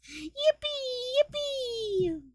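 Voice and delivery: tiny, very high yippee voice; squeaky cheer. Pretend. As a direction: squeal it in a cute little falsetto. Cheering about a week off.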